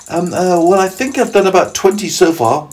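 put-on high, hesitant then steadier boy voice; James. James answering the centipede, high and hesitant at first. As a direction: work it out in a high boy's voice. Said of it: "James told him".

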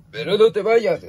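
high, loud plea not to leave; urgent protest. Real, not acted. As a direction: call out after someone leaving. Pet is leaving again. (súplica aguda y urgente)

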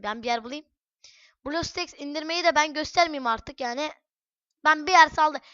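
ordinary talk

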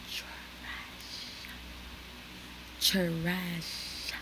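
breathy whisper on trash. Real, not acted. Dissing a mall she hates, in a whisper. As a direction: hush it like a secret insult.

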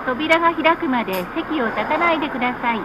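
ordinary talk, nothing marked

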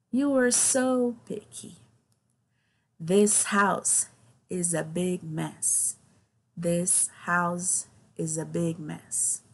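very slow, carefully spaced words; slow pronunciation model. Shown as a demonstration. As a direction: stretch each word out for learners. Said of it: "I'm gonna go slow now". (pronúncia bem lenta e pausada)

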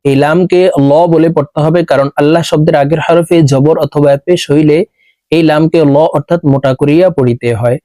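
ordinary talk

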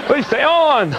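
high-pitched, pleading dim-witted president; Bush impression. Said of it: "my whole Bush impression".

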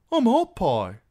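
put-on high, loud boyish blurt; eager boy. A narrator's reading. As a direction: blurt it out like a keen lad. Boy blurts out his name.

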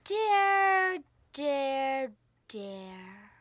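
drawn-out chant, dropping like a fading echo; teasing echo. Acted. Teasing game, repeated fading word.

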